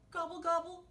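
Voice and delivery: high gobbling turkey, lightly grumbled; grumbling turkey. A narrator's reading. Turkey told he is too short, high mock-grumbled gobble. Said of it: "grumbled Turkey".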